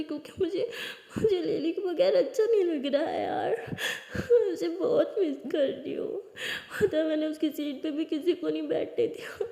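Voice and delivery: acted sobbing, high and broken up; tearful sobbing. Friend missing Lily pours it out in class, acted crying. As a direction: wail it through hammed-up tears, dragging the last words. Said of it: "बस रोने लग जाती है".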